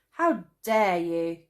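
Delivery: loud, slow outraged cry; indignant cry. A narrator's reading. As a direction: hurl it at the tree. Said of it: "cried Traveller Cane".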